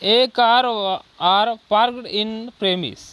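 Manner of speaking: very slow, word by word, with the article "A"; slow example read. Shown as a demonstration. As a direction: dictate the wrong version slowly. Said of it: "जैसे". ("A car" धीमे-धीमे पढ़ना)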